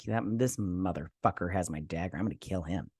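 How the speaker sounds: low, quiet mock seething; very upset. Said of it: "She is very upset about it".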